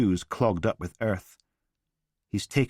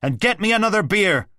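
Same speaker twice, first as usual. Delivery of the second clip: much louder, higher bellow from another room; 13 semitones higher, 8 dB louder than usual. A narrator's reading. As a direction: bellow it across the house. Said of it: "Father's voice bellows out".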